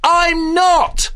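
loud, high-pitched indignant yell; exasperated shout. Real, not acted. Critic denying he criticises computer games, exasperated shout. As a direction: explode in protest at the accusation. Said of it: "I bet you don't shout".

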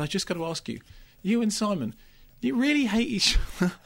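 lightly voiced Branagh, rising to a high incredulous question; a hint of Branagh asking in disbelief; Kenneth Branagh quoted. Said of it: "he said".

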